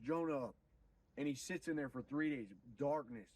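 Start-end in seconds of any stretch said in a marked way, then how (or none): none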